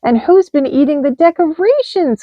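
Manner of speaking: little mouse squeak; baby mouse asks, squeaky. A narrator's reading. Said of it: "squeaked a baby mouse".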